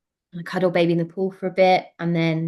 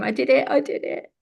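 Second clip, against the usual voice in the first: higher joyful cry, half-replayed; retold joyful scream; 6 semitones higher than usual. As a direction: relive the moment of triumph. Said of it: "you can hear me scream".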